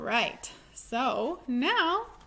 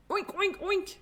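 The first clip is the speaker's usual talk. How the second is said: playful mock oinking; silly pig noise to tease the kids; pretend wrong animal sound; 7 semitones higher than usual. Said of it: "that doesn't sound quite right".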